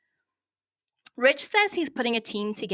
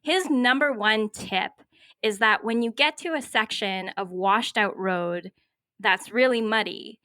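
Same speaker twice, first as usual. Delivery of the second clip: ordinary delivery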